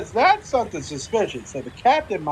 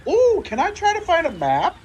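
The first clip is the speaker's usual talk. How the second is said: excited; 11 semitones higher than usual. Real, not acted. Eager question to the GM.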